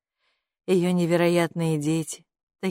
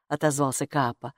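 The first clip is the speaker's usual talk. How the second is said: usual speaking voice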